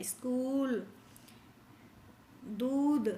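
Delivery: स्कूल and दूध with a long held ऊ; long u drawn out. Shown as a demonstration. Teacher showing the class the long ऊ sound. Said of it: "ये बड़ी ऊ की मात्रा देर तक बोले जाते हैं".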